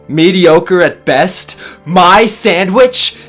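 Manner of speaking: loud, high, rising incredulous question; indignant disbelief. Acted. Sandwich maker hears his creation insulted, indignant. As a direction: throw the insult back, affronted. Outraged echo of boss's verdict.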